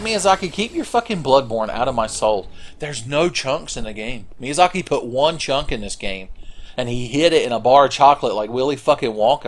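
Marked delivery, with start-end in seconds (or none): none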